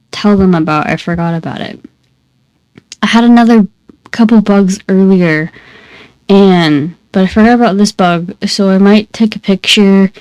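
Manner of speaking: plain reading voice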